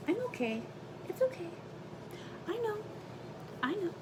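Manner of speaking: high, soft comforting coo; soothing the dog. Real, not acted. Owner calming her whining dog, in a high, soft coo. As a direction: coo it gently, settling a fretful dog.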